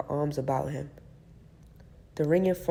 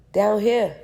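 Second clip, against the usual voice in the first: calling out; 6 semitones higher, 8 dB louder than usual. Acted. Calling up from downstairs.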